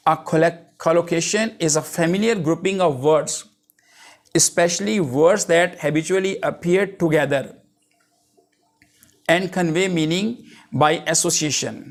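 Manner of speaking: reading a definition out slowly, word by word; slow dictation. Acted. Teacher dictating a definition to the class, slow and deliberate. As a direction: dictate it so students can write it down.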